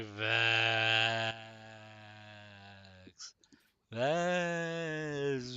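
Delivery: slow, drawn-out ghostly moan. Pretend. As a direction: stretch the name like a haunting ghost. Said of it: "I wanted to sound like a ghost".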